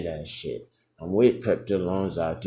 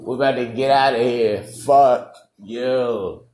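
loud, raised-pitch comic tirade; mock defiant rant; 4 semitones higher, 7 dB louder than usual. Senior to angry classmates after the prank, mock defiant rant. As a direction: yell back at them, hammed up.

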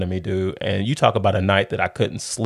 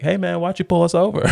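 cocky, raised-pitch question to a cop; cocky teen boldly questioning the officer, pitched higher; 9 semitones higher than usual. Retold or imitated. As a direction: ask the cop boldly, like you own the place. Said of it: "asked the officer just with all boldness".

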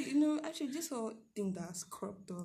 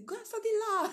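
higher, commanding parent voice; imitating parents; 12 semitones higher, 4 dB louder, faster than usual. Parents ordering her to study law, mock command. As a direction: play the parent who sets the career. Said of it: "that my parents say".

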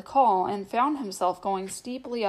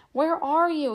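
angry and afraid; 7 semitones higher, 3 dB louder than usual. A narrator's reading. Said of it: "both angry and afraid".

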